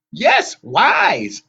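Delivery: put-on high, loud, self-satisfied old sister; grinning hag. A narrator's reading. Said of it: "Anger grinned in the rearview mirror".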